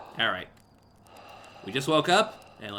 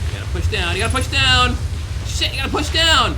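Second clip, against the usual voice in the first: rising frantic shout, higher and louder; panicked yelling; 11 semitones higher, 8 dB louder than usual. Real, not acted. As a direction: yell it in growing panic. Panicked failing at controls.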